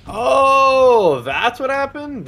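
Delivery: high, slow shocked gasp; drawn-out high disbelief; surprised. Real, not acted. Learning a scandal from chat.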